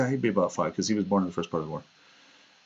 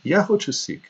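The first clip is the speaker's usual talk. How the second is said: put-on high, childlike "Ya hochu seek"; imitating the boy; 9 semitones higher than usual. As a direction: chirp "Ya hochu seek" like a little kid. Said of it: "Mama, I want juice".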